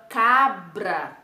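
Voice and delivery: CABRA said slowly, R tapped after the B; drawn-out CABRA with a clear "br"; sounding out. Shown as a demonstration. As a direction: model CABRA slowly for a learner. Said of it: "Presta atenção aí no som das letras".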